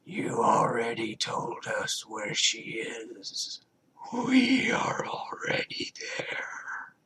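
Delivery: slow, airy whisper, pitch sliding way up; eerie hive whisper. A narrator's reading. Hive mind luring Jacob toward Rebecca, whispering. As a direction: coax him like a creeping voice. Alien voice replies.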